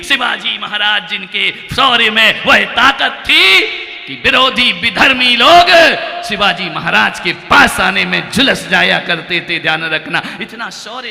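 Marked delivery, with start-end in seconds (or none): 0.0-9.6 louder, rising fiery delivery; heroic preaching. Real, not acted. Monk praises Shivaji's valour in a loud fiery voice. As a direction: let the words throw sparks.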